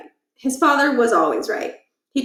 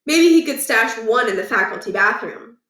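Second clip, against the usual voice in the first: usual speaking voice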